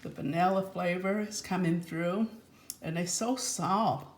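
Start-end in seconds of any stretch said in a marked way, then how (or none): none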